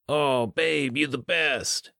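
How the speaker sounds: high, gushing thanks; high, delighted and gushing; high excited gush. A narrator's reading. Benny's delight.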